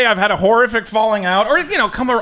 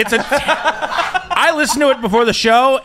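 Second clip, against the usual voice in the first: usual speaking voice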